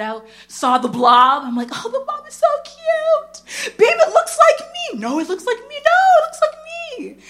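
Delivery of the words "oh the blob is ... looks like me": much higher, slow, laughing squeal; gushing over baby. Retold or imitated. Mom arguing with her husband over the sonogram, giddy squeal. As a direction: coo over the blob like a giddy new mom.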